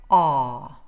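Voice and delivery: R as a held low "ah"; long open vowel. Shown as a demonstration. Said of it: "this indicates tension".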